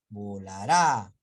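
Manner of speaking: volará with a loud, punched last "rá"; stress on final syllable. Shown as a demonstration. Teacher giving an example, final syllable of volará stressed. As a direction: drive the force onto the "rá" of volará. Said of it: "Tiene que terminar la mayor fuerza de voz".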